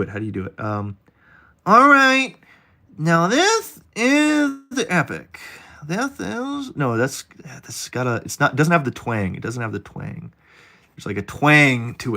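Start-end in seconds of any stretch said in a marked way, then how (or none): 1.6-6.7 high, slow nasal-free nerdy put-on voice; put-on nerd voice. Streamer trying out a nerdy voice for his chat, high and slow. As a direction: pitch way up and drawl it like a know-it-all. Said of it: "how do you do the, uh, Asperger's voice".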